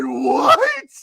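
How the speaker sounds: high, loud outburst of disbelief; shocked yell. Retold or imitated. Storyteller replays his reply to the bossy woman, shocked yell. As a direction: yell back in exaggerated shock.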